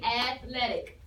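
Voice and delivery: "athletic" said slowly in separated syllables; measured teaching pace on "athletic", syllables apart. Shown as a demonstration. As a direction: break "athletic" into its syllables for learners. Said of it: "It takes three breaths to say".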